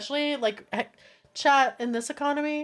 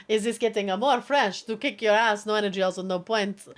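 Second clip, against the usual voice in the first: usual speaking voice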